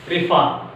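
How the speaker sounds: prefer with the weight on "fer"; stress on second syllable. Shown as a demonstration. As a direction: stress the end of "prefer", R silent. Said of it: "take stress on which syllable".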